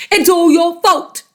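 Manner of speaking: yelled. Acted. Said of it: "yelled Mister Twit".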